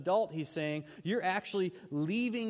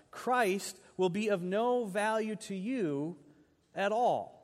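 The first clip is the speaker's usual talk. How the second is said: forceful scripture reading; 3 semitones higher, 3 dB louder than usual. Acted. Preacher voicing Paul warning the Galatians: forceful reading. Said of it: "He says this".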